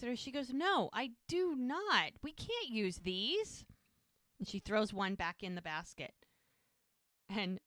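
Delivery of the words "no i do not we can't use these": high, slow "No, I do not"; emphatic refusal. Retold or imitated. Hope rejects the ladies' rice bags, emphatically. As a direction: draw out each word of "do not" in refusal.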